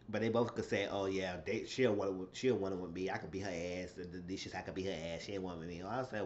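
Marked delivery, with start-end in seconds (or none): 0.9-5.8 low, soft mocking imitation of their bragging; quiet mock boast. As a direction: mutter their tough talk under your breath to belittle it. Voicing both women's boasts.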